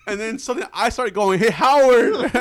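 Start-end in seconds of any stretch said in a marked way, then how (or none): 1.4-2.3 high, loud call through laughter; mock holler. Joining in calling his friend the wrong name, laughing holler. Acts himself starting to call out Howard.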